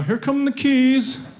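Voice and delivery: high, drawn-out careful announcement; hammed-up slow, non-threatening voice; slow-motion caution. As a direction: stretch every word out very slowly. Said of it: "I hand him the keys slowly".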